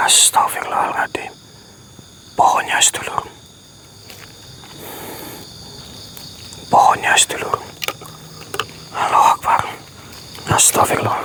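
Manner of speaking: hushed, shocked whisper. Real, not acted. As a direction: whisper prayers and asides. (berbisik kaget)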